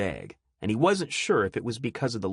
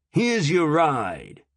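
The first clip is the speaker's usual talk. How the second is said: gruff officer; 7 semitones higher, 5 dB louder, much slower than usual. Acted. Officer speaking.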